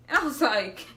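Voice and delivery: usual speaking voice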